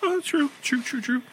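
very high, soft squeaky agreeing; squeaky agreement. Acted.

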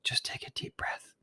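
hushed, breathy whisper; whispered calming. Real, not acted. Calming down mid-rant about customers, whispered. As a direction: whisper it softly, almost without voice. Steadying self after worked-up talk.

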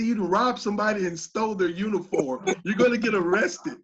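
loud, comic mock accusation; playful telling-off, breaking into a laugh; joking accusation. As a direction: accuse him for laughs, loudly. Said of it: "I didn't say it in a jokingly manner".

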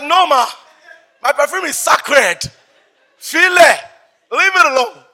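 loud, high mock outrage; indignant refusal. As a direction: shout it like a possessive only child. Acts refusing mother the perfume.

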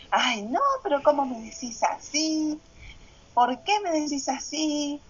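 mock offended pushback at her partner; annoyed protest. Retelling her irritated reply to her partner, a touch whiny.

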